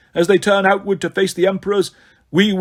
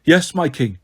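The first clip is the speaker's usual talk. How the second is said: usual speaking voice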